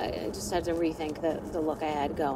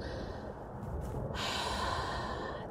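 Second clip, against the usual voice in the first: long sigh; 11 dB quieter than usual. Real, not acted. Sigh before the bad picture.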